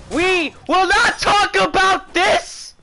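high, slow, forceful shout; emphatic shouted protest. Real, not acted. Gamer refuses to discuss the lost round, shouted protest. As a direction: push out each word in protest.

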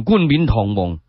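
ordinary delivery

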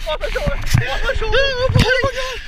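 very high, loud shriek of joy; hooting and hollering. Real, not acted. Friends celebrating a landed river monster, ecstatic hollering.

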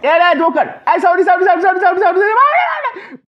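high, rising frantic shouts of sorry, slowing at the end; panicked sorry. Real, not acted. Caught player yelling frantic apologies at the granny, high panicked shout. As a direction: beg forgiveness in a squealing panic as she grabs you.

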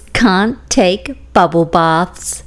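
slow, lively picture-book reading; children's story narration; storybook reading. A narrator's reading. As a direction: read it out to little kids.

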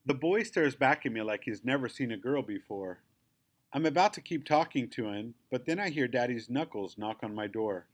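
ordinary delivery, nothing marked

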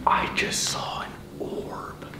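hushed real whisper, almost no voice. Real, not acted. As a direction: whisper it so the ghost won't hear. Quiet orb sighting on camera.

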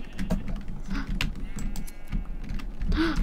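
long airy gasp; drawn-in breath of surprise; unvoiced gasp; breathy gasp. Real, not acted. Unexpected find.

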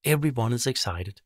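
ordinary delivery

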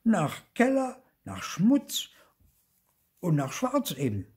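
high little ghost listing smells; piping, insistent explanation; Zipfel. A narrator's reading. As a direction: list them loudly, piece by piece. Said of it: "sagte auch Zipfel jetzt".

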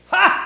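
sharp, high, loud scornful ha; mocking laugh. Preacher voicing guards taunting Jesus with a loud mocking ha.

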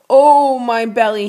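slow, loud pained wail; wailing father in agony. A narrator's reading. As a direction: cry out in pain, dragging it. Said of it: "he wailed".

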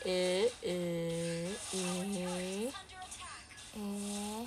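low, drawn-out hesitant eh sounds; mock hesitant eh. Imagining herself stuck, a low hesitant eh. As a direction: stall with a low uncertain eh. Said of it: "Terus ntar aku kayak".

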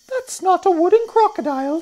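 very high, loud singsong cry; high-pitched piercing bird voice; Roly-Poly Bird. A narrator's reading. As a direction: shriek a bird's urgent warning. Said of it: "sang the roly-poly bird".

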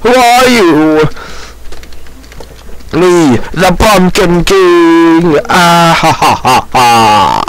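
slow, drawn-out evil king voice; hammy villain. Acted. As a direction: gloat theatrically with a big evil laugh.

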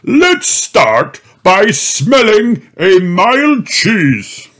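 put-on loud, high jolly old rabbit; Grampy Rabbit voice. Grampy Rabbit greets Mandy, jolly old rabbit. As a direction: greet like a hearty grandpa.

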